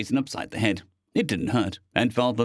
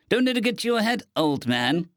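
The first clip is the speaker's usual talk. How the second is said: snarky; 10 semitones higher than usual. A narrator's reading. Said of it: "Stowaway snarked".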